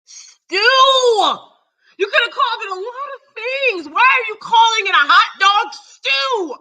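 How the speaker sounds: outraged disbelief at a dish's name; incredulous. Real, not acted. Mocking mama's hot dog stew.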